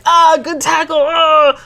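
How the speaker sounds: loud, high cheering for a tackle; excited fan cheer. Retold or imitated. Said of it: "here I am".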